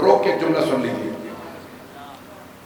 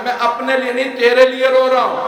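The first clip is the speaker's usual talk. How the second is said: high-pitched impassioned preacher, building to a drawn-out shout; preaching at full force; 11 semitones higher than usual. Retold or imitated. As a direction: climb higher and slower into a ringing climax. (پورے جوش سے خطابت، اونچی آواز میں کھینچ کر)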